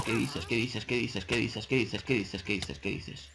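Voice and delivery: quiet, stunned repetition dying away; fading disbelief. Real, not acted. Player attacked by a witch in the cave, stunned muttering.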